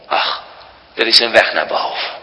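ordinary delivery

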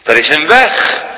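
normal talking voice

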